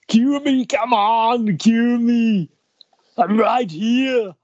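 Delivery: high, loud cue me; loud playful demand. Pretend. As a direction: shout it playfully.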